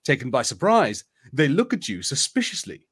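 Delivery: ordinary talk, nothing marked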